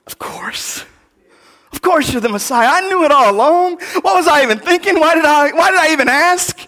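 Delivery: high, loud voice of a man kicking himself; exasperated self-mockery. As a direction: play John groaning at his own doubt. John facepalming, mock self-scolding.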